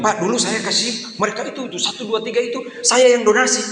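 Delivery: high-pitched, rising boast to the pastor; complaining church member. Acted. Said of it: "Jangan bilang begini". (jemaat mengeluh dengan nada tinggi)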